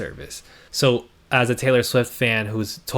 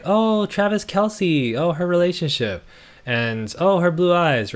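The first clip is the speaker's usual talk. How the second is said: mock fan delight; 7 semitones higher than usual. Mocking fans' delighted reactions.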